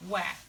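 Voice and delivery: "wet" ending open with no t sound; wet without the T. Shown as a demonstration. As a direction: drop the final t in "wet". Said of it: "like the word wet without the T".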